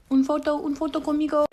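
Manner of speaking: put-on lightly accented hawker's call; mock Spanish accent. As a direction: imitate a costumed Elsa touting photos. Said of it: "she's over there like".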